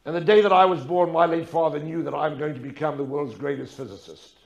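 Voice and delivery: usual speaking voice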